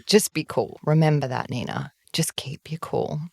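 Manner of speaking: dropping down to steady your own nerves; self-coaching. Retold or imitated. Coaching herself before speaking to her.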